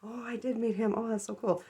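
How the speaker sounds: dawning realization. Retold or imitated. Telling friends she did meet him after all, dawning realization. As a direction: act out suddenly remembering. Re-enacts suddenly remembering the meeting.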